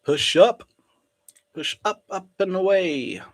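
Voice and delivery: egging the chart on; cheering on. Real, not acted. Cheering the price upward.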